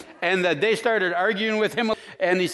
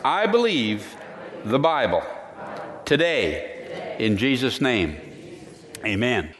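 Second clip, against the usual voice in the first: solemn faith declaration; 5 semitones lower than usual. Acted. Opening creed declaration with music.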